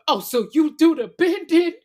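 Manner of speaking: high, loud taunting woman's voice; mocking [name]. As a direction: shriek it like a sassy accuser. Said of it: "Why you sound just like".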